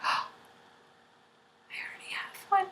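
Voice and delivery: small gasp, then a whispered aside; retold awkward whisper. Retold awkward reaction to gift.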